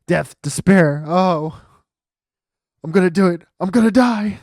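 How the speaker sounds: hammed-up doom wail; screaming despair. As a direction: scream like the end has come. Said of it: "your client screaming over there".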